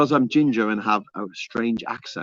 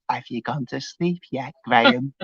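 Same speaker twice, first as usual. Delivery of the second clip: imitating a creepy bedside uncle; mock-creepy uncle, voice held back. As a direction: play the creepy uncle checking on a sleeper. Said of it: "the creepy uncle".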